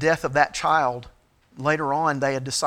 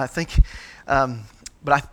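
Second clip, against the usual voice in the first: ordinary talk, nothing marked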